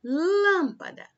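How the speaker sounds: lâmpada with a loud, high, long lâm; drawn-out LÂM in lâmpada, high and loud; stress on first syllable. Shown as a demonstration. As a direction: hit and hold the lâm of lâmpada. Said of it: "lã é a sílaba mais forte".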